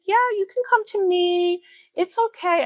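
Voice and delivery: high, sing-song coaxing voice; lilting high call to a nervous moth. Retold or imitated. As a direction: coax it close like a pet. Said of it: "I'm like".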